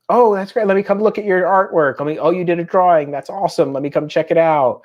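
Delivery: upbeat parent showing interest; encouraging dad. Retold or imitated. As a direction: gush over a child's drawing, then settle. Acted encouraging dad to his child.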